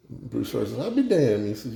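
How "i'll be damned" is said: high-pitched Cajun amazement, lightly acted; Cajun accent. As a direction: marvel with a touch of Cajun. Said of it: "you guys wanna hear a Cajun joke".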